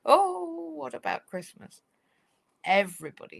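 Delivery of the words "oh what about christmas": high wailing "Oh", a touch of mock worry; hammed-up fretting staff member; mock panic. Staff just told of job losses, mock panicked wailing. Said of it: "because those around were".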